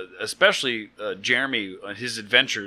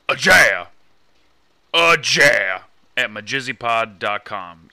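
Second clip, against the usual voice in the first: put-on loud, slow Russian accent turning Scottish; 7 dB louder, slower than usual. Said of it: "Your Russian accent got all Scottish".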